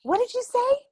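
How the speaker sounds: high, loud daring question; challenging. Retold or imitated. Aunt daring her nephew to repeat himself, high and loud. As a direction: dare him to say it again. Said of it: "I was like".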